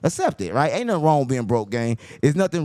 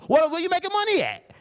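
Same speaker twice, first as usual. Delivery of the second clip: mocking Dame. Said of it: "You go".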